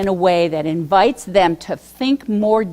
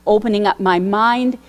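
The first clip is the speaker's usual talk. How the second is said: normal talking voice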